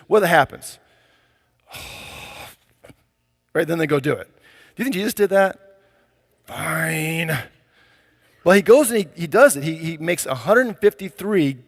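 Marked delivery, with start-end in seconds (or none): 1.7-2.4 long, heavy exhale; put-upon sigh of a child given chores; reluctant sigh. Acted. Kid told to clean the dishes, sighing reluctantly. 6.5-7.4 drawn-out, monotone, reluctant giving in; sulky kid giving in to mom; grudging compliance. Acted. Jesus giving in to his mom's request, grudgingly.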